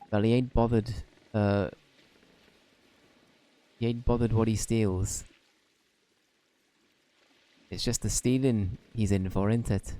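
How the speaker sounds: put-on lightly Welsh-accented fairy sidekick, chatty; Welsh Drippy voice. Drippy chats to Oliver in a mild Welsh accent.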